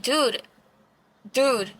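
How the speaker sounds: ordinary talk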